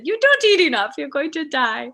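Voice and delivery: high, loud mock scolding in British accent; hammed-up British warning, starting high then dropping; British grandmother. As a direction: imitate a British grandma fussing dramatically. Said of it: "And then she said".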